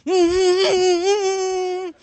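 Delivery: loud quavering squeal; little girl's acted whimper; high-pitched wordless whimper. Frightened girl's wordless answer.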